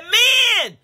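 high, loud call to men; yell. Retold or imitated. Preacher retelling her shouted post to the men. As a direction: bark the word out like a rallying cry. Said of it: "Why you gotta yell?".

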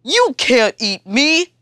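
elephant voice. Acted. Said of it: "says Elephant".

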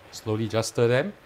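ordinary talk

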